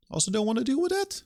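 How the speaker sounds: a developer fed up with contracts repeats the line in a squeaky high whine. Shown as a demonstration. Third take, squeaky high.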